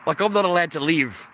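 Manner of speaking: ordinary talk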